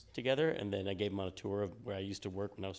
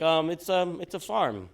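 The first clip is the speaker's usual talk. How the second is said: mock innocent answer; 9 semitones higher, 12 dB louder, slower than usual. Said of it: "We're like".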